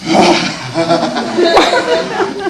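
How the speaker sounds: big, breathy sigh of desire; rapturous sigh. Acted. Husband smells wife's perfume, rapturous sigh. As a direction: sigh as the scent takes you.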